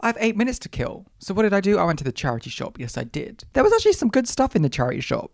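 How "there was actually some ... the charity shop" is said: high, fast and excited. Real, not acted. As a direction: rush it out with delight. Excited about good stuff.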